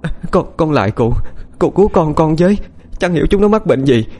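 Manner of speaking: high, desperate begging; a mother begging the elder for her children's lives; frantic pleading. A narrator's reading. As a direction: beg the old man to save them. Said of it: "bèn rối rít van xin".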